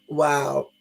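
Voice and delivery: normal talking voice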